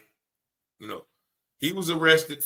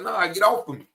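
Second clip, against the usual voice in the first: retold protest, pitched up; 7 semitones higher, 4 dB louder than usual. Man to officer trying to grab him, protesting. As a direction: imitate someone refusing to be grabbed, voice lifted.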